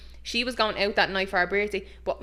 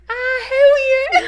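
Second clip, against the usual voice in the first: drawn-out, sky-high shriek imitating dad; hammed-up falsetto yell; voice shooting up octaves; 11 dB louder, much slower than usual. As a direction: screech it slowly and very loud. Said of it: "his voice goes up about ten octaves".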